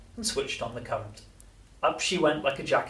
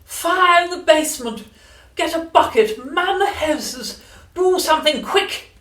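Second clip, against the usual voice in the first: frantic high screeched orders; panicking grandma raising the alarm; old woman yelling; 10 dB louder than usual. A narrator's reading. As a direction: shriek each command at full volume, stretched out. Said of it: "the old woman yelled".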